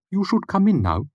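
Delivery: put-on high, muffled odd old man; an oddball asking from behind a door; eccentric old man. A narrator's reading. Puppet voiced.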